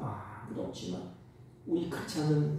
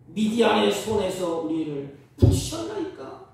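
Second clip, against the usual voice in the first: loud, higher, lamenting protest read from scripture; loud complaining prayer; 6 semitones higher, 6 dB louder than usual. Retold or imitated. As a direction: hurl the complaint up to heaven. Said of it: "기도원의 불평은".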